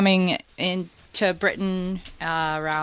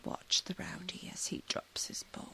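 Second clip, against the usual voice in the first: whisper; 18 dB quieter than usual. Real, not acted. Whispering while the dog plays.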